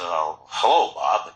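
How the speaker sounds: low, wary hello; reluctant low-pitched greeting; unimpressed greeting. Retold or imitated. His young self greets old stranger.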